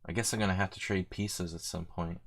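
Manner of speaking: usual speaking voice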